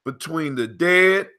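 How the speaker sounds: slow, measured proverb reading with pauses; proverb recital. Acted. Host reads the proverb to his co-host, measured recital. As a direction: solemnly recite it, phrase by phrase.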